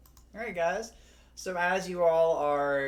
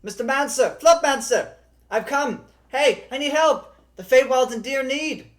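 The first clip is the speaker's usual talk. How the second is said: high, loud desperate shouting; shouting elf; 12 semitones higher, 11 dB louder than usual. Acted. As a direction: burst in yelling for help. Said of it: "shouting at the top of her lungs".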